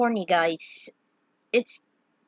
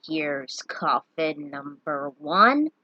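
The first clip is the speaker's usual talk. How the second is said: low, slow character voice; 5 semitones lower, much slower than usual. Acted.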